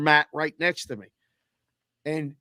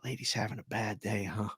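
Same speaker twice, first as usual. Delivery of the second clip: much softer, confiding little aside; quiet aside; 6 semitones lower, 12 dB quieter than usual. Retold or imitated. Joking softly to an elderly woman nearby. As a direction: say it under your breath to a fellow traveller. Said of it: "and I said".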